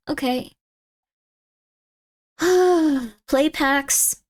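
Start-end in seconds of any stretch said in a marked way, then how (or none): 2.4-3.1 high, voiced sigh; exasperated sigh. Real, not acted. Streamer facing yet another product, exasperated sigh.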